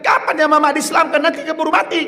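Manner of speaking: mother's voice pitched high and urgent; mother pressing her son to convert her, high and eager; eager old mother. Retold or imitated. Mother urging son, high-pitched.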